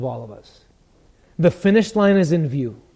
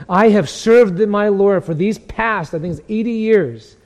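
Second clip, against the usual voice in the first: loud, slow, high proclamation; Polycarp's defiant declaration; 4 semitones higher, 7 dB louder, slower than usual. Acted. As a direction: declare it like an unbending martyr. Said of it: "What did Polycarp say?".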